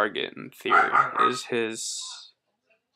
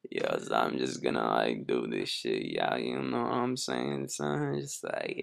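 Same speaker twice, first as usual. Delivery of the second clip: [name] voice; 12 semitones lower, 4 dB quieter than usual. Pretend. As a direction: drop way down into a lazy rapper voice. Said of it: "I should do the rest of this like [name]".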